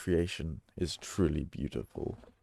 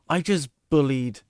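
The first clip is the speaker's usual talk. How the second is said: shocked; 7 semitones higher, 8 dB louder than usual. Real, not acted. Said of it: "an ill child".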